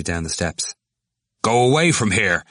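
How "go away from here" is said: higher-pitched rude order; rude dismissal. A narrator's reading. Cranley shoving the student away, rude. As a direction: send him off rudely. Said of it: "he said rudely".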